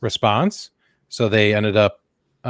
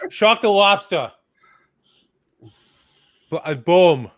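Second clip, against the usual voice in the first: put-on Boston accent; 5 semitones higher, slower than usual. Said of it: "You gotta do it with a Boston accent".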